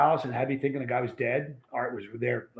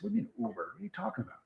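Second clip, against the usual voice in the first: hushed, higher mock disbelief; incredulous retort; 5 semitones higher, 13 dB quieter, much faster than usual. Telling how he questioned the friend leaving, incredulous. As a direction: softly re-enact a baffled protest. Retelling his startled reply to friend.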